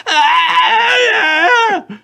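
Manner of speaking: long, loud, high scream; continued scream. Acted. Tyler punches the creature's eye, still screaming. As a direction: keep one high scream going full out. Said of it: "still screaming".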